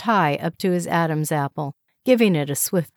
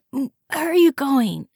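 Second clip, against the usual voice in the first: higher, strained rasp; hoarse whisper; 9 semitones higher, 3 dB louder than usual. A narrator's reading. Hungover wife asking husband, hoarse. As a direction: push it out through a raw throat. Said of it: "she managed to whisper hoarsely".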